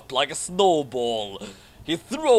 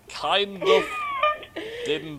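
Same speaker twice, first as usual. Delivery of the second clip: laughing; slower than usual. Real, not acted. Breaks up laughing at sentence.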